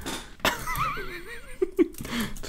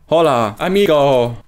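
slow, drawn-out, louder hammed-up Spanish hello; mock Spanish greeting; 4 dB louder, slower than usual. Gamer jokingly greets another player in mock Spanish, slow and louder. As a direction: greet him like a cheesy tourist. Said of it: "żartowałem z tym amigo".

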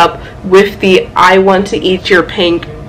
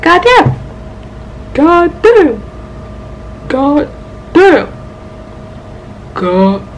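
high, drawn-out exclamation, sinking with each repeat; imitating an audio clip; 7 semitones higher, 3 dB quieter, much slower than usual. Vlogger quoting that one audio, mock astonishment. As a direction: mimic a viral sound, starting high and winding down. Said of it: "You know that one audio? It's like".

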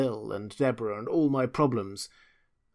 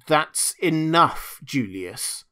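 loud, slow, controlled anger; stern warning; 5 semitones higher, 7 dB louder, much slower than usual. Acted. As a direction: put a firm stop to it. Lil cutting him off, rage in check.

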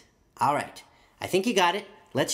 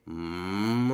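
low, drawn-out cow moo; 10 semitones lower than usual. Shown as a demonstration. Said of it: "make a cow pose and a cow face and say".

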